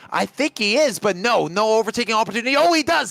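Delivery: fast, rising race commentary; excited play-by-play. Acted. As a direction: call the overtake live and urgent. Live call of overtaking battle.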